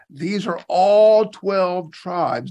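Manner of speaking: slow right down and hit "all" hard; emphatic. Real, not acted. Preacher corrects a common misunderstanding, slow, loud emphasis. Drives home the point to listeners.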